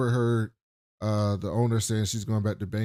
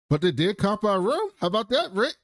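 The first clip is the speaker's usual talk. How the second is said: mock upbeat; 10 semitones higher than usual. Chelsea's upbeat remark to Rick, retold.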